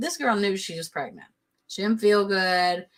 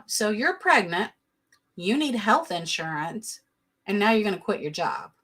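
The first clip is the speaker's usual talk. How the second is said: ordinary talk, nothing marked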